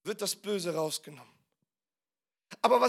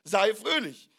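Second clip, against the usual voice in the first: imperative command; 5 dB louder, slower than usual. Shown as a demonstration. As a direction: state it as a firm demand. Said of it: "Imperativ, Aussage, Aufforderung". (nachdrückliche Aufforderung)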